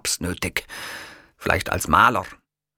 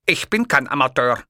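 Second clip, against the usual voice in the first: firm, louder, higher retort; emphatic; 7 semitones higher, 4 dB louder than usual. A narrator's reading. Hitler rebuffing the kiosk man, emphatic and raised. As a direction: set him straight with force. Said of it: "beschied ich ihn mit Nachdruck".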